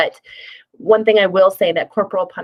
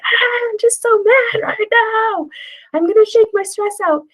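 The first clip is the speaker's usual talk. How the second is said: hammed-up mad parent; 3 dB louder than usual. Caregiver role-modelling stress for kids, silly high mock anger. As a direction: ham up being mad for a child. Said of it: "you can role model this".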